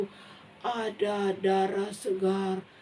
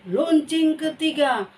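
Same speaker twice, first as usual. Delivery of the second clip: loud announcement; 9 semitones higher, 8 dB louder than usual. Acted. Bell announcement called out.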